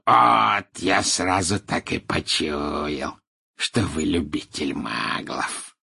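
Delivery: slow, drawling contempt; scornful grunt. A narrator's reading. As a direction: sneer the accusation slowly. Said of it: "хмыкнул Мракс".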